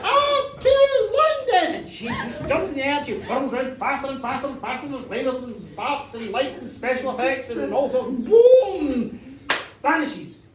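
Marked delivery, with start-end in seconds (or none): 0.0-1.8 very high, loud drawn-out call; high, stretched theatrical shout; shouted spell. Acted. Witch shouts her spell again. 8.2-9.2 loud, raised, held "boom"; big booming bang; explosive boom. Acted. Storyteller imitates magical explosion.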